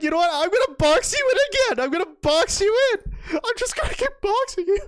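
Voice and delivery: high, giddy imitation of a cocky player; squeaky hammed-up defiance; mock taunting. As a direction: act out bravado while nearly laughing.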